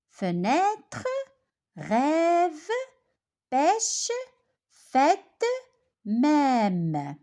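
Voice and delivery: fenêtre, rêve, pêche, fête, même, one at a time, open ê; open ê demo. Shown as a demonstration. Teacher models words spelt with circumflex, open ê demo. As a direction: model each word separately with a clear open ê. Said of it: "la bouche est ouverte".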